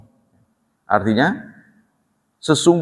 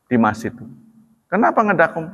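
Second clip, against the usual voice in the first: ordinary talk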